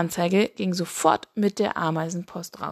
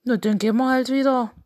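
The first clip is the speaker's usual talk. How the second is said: quick, higher, louder toad voice; pitched-up, hurried toad character; huffy toad; 4 semitones higher, 5 dB louder, much faster than usual. Acted. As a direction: toss it off fast as a rebuffed toad. Rejected toad decides to leave.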